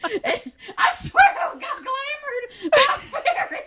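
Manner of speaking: very high, slow squealed protest with laughter; mock protest. Pretend victim swearing a fairy did it, mock frantic.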